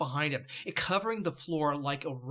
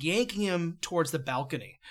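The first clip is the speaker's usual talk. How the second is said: ordinary delivery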